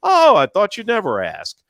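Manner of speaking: gushing mock voice of a self-invited guest; mock delighted guest. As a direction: accept the invite with fake surprise. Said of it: "Would you like to come to my party".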